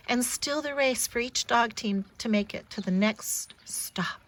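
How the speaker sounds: plain reading voice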